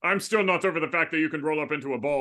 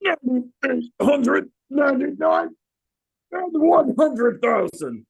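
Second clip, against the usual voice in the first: All Might voice; 7 semitones higher, 5 dB louder, much slower than usual. Acted. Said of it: "meeting All Might".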